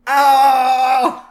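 loud high yelp of pain; mock pained yelp. Homer yelping as the sewing machine stabs him, loud and high. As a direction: yelp like a needle just went through your finger.

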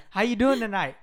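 loud, high casual bro greeting; loud high street-style hey bro. Shown as a demonstration. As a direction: greet a basketball buddy, loose and loud. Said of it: "Like that".

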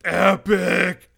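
hammed-up epic shout; epic announcer voice. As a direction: make it sound epic, big and loud. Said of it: "Gotta make it epic".

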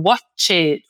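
"watch it" as slow "watch-ED", stressed ending; Brazilian learner error. Shown as a demonstration. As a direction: lean on an added "ed" syllable in "watch it". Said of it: "enfatizar sempre esse E D do final". ("watch it" devagar, com o "ED" final forte)

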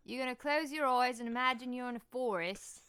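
loud, hammed-up Cockney meditation guide; unsoothing mock Cockney, pushed up high; Cockney accent. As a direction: lead meditation like a brash Londoner. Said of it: "like, a, a Cagney accent".

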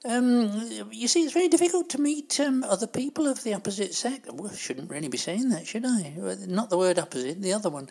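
put-on fussy, gossipy old dear, fluttering up and down; chatty old lady Doris. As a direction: play a prim, scatterbrained pensioner scolding the host. Performs the Doris character throughout.

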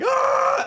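high startled scream of the pranked people; mock terrified scream. Retelling the victims' reaction, a high terrified scream. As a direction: scream like you just saw a ghost.

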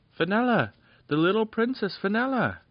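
high, loud cry of wonder; amazed shout. Acted. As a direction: fairies calling out her name in amazement. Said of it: "they shouted in wonder and amazement".

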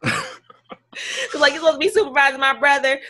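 laughing through the complaint; laughing in disbelief. Real, not acted. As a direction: giggle while venting.

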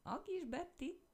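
high, slow surprised cry; child amazed at his mother's old name; surprised exclamation. A narrator's reading. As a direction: blurt it out amazed, pitch jumping up. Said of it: "kiáltott fel a kisfiú".

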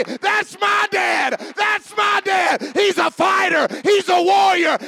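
high, shouted boast, drawn out; acted proud child. Child seeing dad march into the city, acted proud shout. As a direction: cry it out high with pride in your father. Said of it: "They say".

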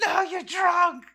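very high, shrieked mock-mom yell; hammed-up screaming scolding. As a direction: screech it like an outraged mother. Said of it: "she's screaming at me".

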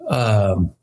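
ordinary talk, nothing marked

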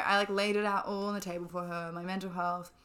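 normal talking voice

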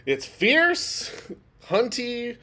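high, loud sassy drag; sassy drag queen. Pretend. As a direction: camp it up, fierce.

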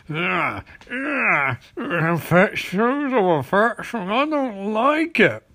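slow, grunting mock revulsion; disgusted villain. Villain watching a couple kiss, mock disgusted. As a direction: drag it out with hammy distaste. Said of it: "the Manyo suit watches them".

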